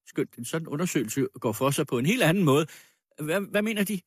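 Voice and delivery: lightly voiced man making excuses; flustered accuser. Accuser stalling over a search of the girl, flustered.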